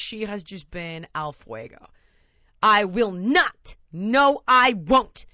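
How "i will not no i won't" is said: put-on loud stubborn toddler voice, slow start; defiant toddler. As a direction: play a defiant little kid refusing. Child defiance acted in story.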